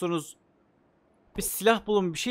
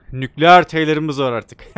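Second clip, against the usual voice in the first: louder and slow, savoring the new nickname; gleeful proclamation; 9 dB louder, slower than usual. Real, not acted. Gamer crowning his dweller with a nickname, gleefully slow. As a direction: announce it proudly, stretching the words.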